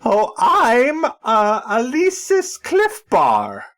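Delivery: slow, high, badly faked British; bad British accent. Guest introduces himself in a bad British accent. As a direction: ham up a posh Brit, slowly. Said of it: "work on your accents before you do them".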